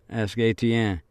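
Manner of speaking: usual speaking voice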